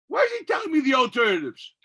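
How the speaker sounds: loud, high exasperated question. Real, not acted. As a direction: demand it, fed up.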